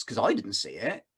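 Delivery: ordinary delivery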